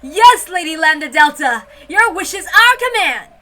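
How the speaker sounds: loud, eager servant chorus. Acted. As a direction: shout it like devoted little servants. Servants answer the witch.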